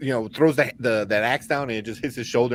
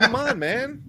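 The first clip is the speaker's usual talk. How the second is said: high-pitched mock exasperation; exasperated groan; 13 semitones higher, slower than usual. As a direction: whine it like a let-down fan. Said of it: "I was like".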